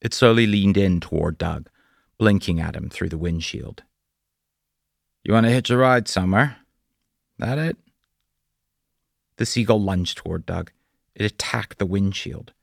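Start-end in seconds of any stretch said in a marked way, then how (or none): 5.2-7.8 quick, amused, rising up high at the end; teasing question. Acted. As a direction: kid the bird along, quick and light. Doug teasing the seagull.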